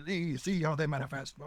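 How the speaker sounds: high, pointed mock hostel master; mock preacher voice. Hostel master to the laughing boy, a high triumphant mock preacher. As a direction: play the zealot pointing at proof of demons.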